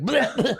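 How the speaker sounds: high spat-out bleh; spitting bleh. Acted. Gnoll spits out the hot fish, a high bleh. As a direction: spit the hot food out. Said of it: "and he, like".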